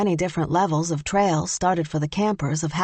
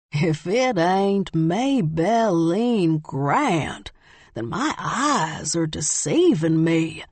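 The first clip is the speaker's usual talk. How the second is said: slow, drawn-out Southern drawl from a hiker; Southern accent, heavy twang, slowly teasing; much slower than usual. A narrator's reading. Bobby Ray teasing Maybelline with a heavy Southern twang. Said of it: "he said with a heavy southern twang".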